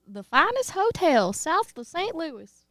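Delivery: drawn-out Texas country twang with swooping pitch; country accent. Shown as a demonstration. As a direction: lay on a thick Southern country accent. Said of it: "with a more country accent".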